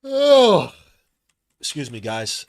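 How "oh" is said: big vocal yawn, higher; real yawn. Real, not acted. Streamer woke up early, real loud yawn. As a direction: let out a loud, tired yawn. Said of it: "I'm not yawning 'cause it's boring".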